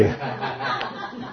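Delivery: quiet breathy chuckle; soft laugh. Real, not acted. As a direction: laugh softly at the memory. Laughs at the story. (risa suave)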